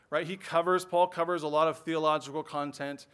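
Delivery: ordinary delivery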